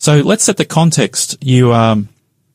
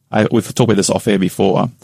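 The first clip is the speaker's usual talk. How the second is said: ordinary talk, nothing marked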